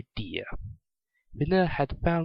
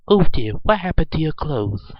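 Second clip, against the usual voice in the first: loud, higher startled mother; shocked gasp; 4 semitones higher, 10 dB louder than usual. A narrator's reading. Mother shocked at the unclothed pony, loud surprise. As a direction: exclaim in motherly alarm. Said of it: "she gasped".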